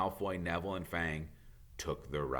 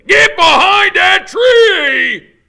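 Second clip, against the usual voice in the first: much higher, much louder, slow warning shout; urgent shout; 24 dB louder, much slower than usual. Acted. Hagrid shouts a warning to the children, slow and loud. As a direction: bellow it like a sudden alarm.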